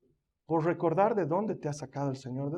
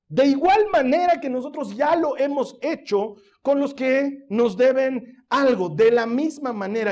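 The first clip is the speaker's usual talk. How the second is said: fervent preaching; 5 semitones higher, 6 dB louder than usual. Acted. Preaches with rising force.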